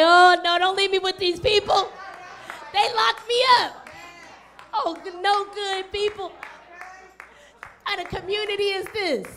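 loud panicked begging, voice shooting up; loud, desperate plea not to be left behind; desperate pleading. Acted. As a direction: cry out like a frightened man clinging to his rescuer. Freed demoniac begs Jesus.

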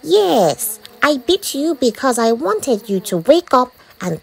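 high, emphatic insect voice; mosquito character. A narrator's reading. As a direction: lecture the boy as a bossy mosquito. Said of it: "the mosquito replied".

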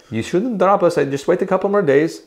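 put-on high, playful Scandinavian accent; mock Norwegian accent. Lead singer pleads with booking agency, mock Norwegian accent. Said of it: "I'm just doing the accent".

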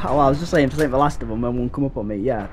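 ordinary talk

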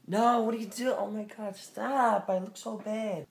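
put-on girl caught on camera protesting; mock girly protest. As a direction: fake a flustered girl. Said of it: "girls catch girlfriends recording".

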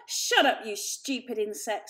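loud contemptuous snarl at a kitten. A narrator's reading. Julius silencing the kitten Varjak, snarling loudly. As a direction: bark it down at the little one. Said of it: "snarled Julius".

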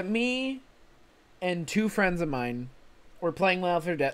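ordinary talk, nothing marked

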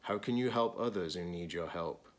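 ordinary talk, nothing marked

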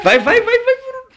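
very high, laughing go go go; excited laughing cheer. Real, not acted. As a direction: squeal it out while laughing. Cheering a push, nearly there.

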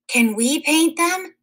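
put-on high pleading kids' voice; begging children. A narrator's reading. Children circling the woodcutter, begging to paint. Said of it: "the children begged".

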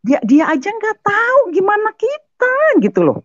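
high-pitched incredulous outburst; exasperated disbelief. Real, not acted. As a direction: throw up your hands in shocked disbelief. Exasperated at the verse.

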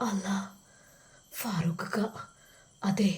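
hushed whisper of surprise. A narrator's reading. Seeing who's calling, a hushed whisper. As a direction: breathe the name under your breath. Sees the caller on phone.

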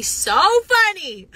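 excited exclamation. Real, not acted. Hyping planned podcast episodes to viewers, excited exclamation. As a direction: gush loudly with a high swoop.

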